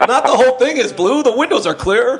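high, helpful-sounding useless detail; clueless caller. Retold or imitated. Witness adding details for 911 operator, high and clueless. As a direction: offer it brightly high, half laughing.

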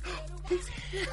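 slow, high, hushed wonder; awed hush. Retold or imitated. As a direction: breathe it out in awe. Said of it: "I was just in the bed like".

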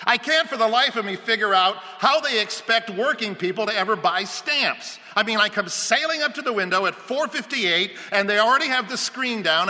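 high, loud exasperated complaint; indignant rant. Acted. Homeowner rants to researcher about Postal Service, indignant. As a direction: vent with mounting outrage.

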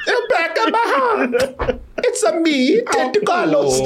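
a jokey fake Italian accent as Jared Leto's octopus; lightly voiced Jared Leto octopus in a fake Italian accent; mock Italian accent. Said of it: "put Jared Leto as the octopus".

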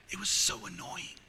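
normal talking voice

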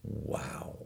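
breathed, barely voiced wow; awed whisper. Shown Elvis's prized x-rays, he breathes a whispered wow. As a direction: hush it out in quiet amazement.

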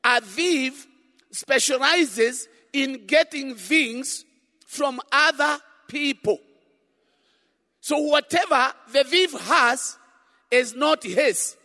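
loud fervent sermon; preaching. Real, not acted. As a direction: preach it with full force. Preaching at full force.

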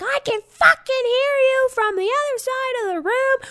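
very high mock screech; yelling. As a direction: imitate a furious friend shrieking through a door. Friend yells through closed door.

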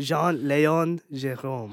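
slow "Jean-Léon Jérôme" in a French accent; careful French-accented demonstration. Shown as a demonstration. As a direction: model the French accent slowly, R from the throat. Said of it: "Say it with a French accent".

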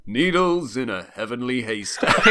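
put-on slow, grand old-film narration in an American accent; drawn-out American narrator impression; voiceover narrator. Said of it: "a voice sort of goes".

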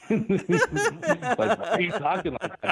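high, laughing imitation of a puzzled caller; laughing retelling. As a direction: laugh through the quoted question. Laughing while retelling a caller's question.

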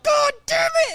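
high, loud imitation of his cursing; mock angry outburst. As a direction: burst out in exaggerated frustration like him. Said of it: "He was like".

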